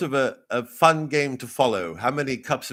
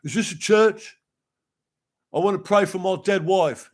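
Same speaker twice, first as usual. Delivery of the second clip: much higher, louder commoner's voice; pleading churchgoer; 9 semitones higher, 5 dB louder than usual. Acted. Bereaved churchgoer asking to pray, high pleading voice. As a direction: plead loudly in a raised character voice. Acted churchgoer turned away at door.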